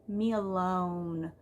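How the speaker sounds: very slow, drawn-out leave me alone; exhausted mom stretching every word; worn-out plea. Retold or imitated. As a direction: drag it out like you're at the end of your rope. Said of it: "I'm just like".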